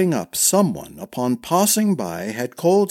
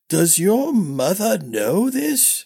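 put-on high, slow, creaky old master, puzzled; a forgetful old knight squinting; doddering old man; 8 semitones higher, slower than usual. A narrator's reading. Old master Crushmore voiced.

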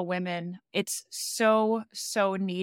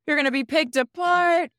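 higher, louder playful doomsaying; mock doom voice; 6 semitones higher, 4 dB louder than usual. Host piling onto guest's irrational fear, mock doom voice. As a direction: join in with hammed-up dire warnings.

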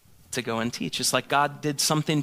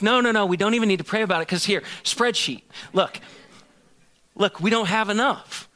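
rushed brush-off; 6 semitones higher than usual. Retold or imitated. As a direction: rattle it off, waving away prayer. Dismissing her with spreadsheet.

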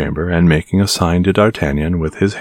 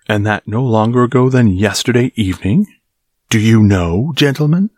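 cried angrily; 5 semitones higher than usual. A narrator's reading. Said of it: "cried he".

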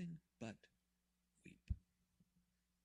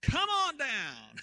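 much higher, much louder, slow drawn-out game show call; game show host; 12 semitones higher, 10 dB louder, much slower than usual. Pretend. Jesus calls Lazarus out, game show host style. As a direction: hype him up like a game show host calling a contestant. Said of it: "Price is Right kind of moment".

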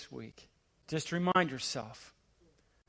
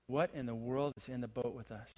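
much softer and lower, wondering; awed hush; 8 semitones lower, 11 dB quieter than usual. Acted. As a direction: breathe the question in awe. Awed disciples after the hush.

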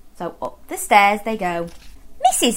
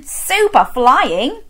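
usual speaking voice

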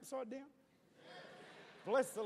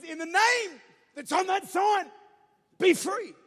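louder, higher, slow command; dad casting the demon out, full force; commanding shout; 6 semitones higher, 13 dB louder, slower than usual. Retold or imitated. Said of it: "He says".

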